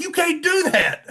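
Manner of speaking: high, loud mock outcry; retold alarmed scolding. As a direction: imitate staff exclaiming in alarm. Retold alarm from test staff.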